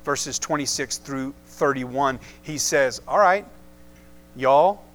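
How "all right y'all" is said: lightly put-on southern accent; a touch of southern in the voice. Paul addressing the Corinthian church, in a mild southern accent. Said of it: "in his good southern accent".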